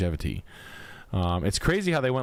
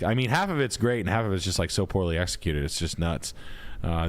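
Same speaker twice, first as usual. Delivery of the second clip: ordinary delivery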